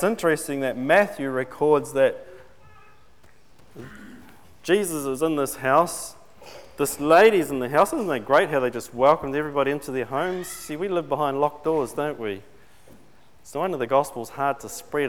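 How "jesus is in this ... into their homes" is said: ordinary talk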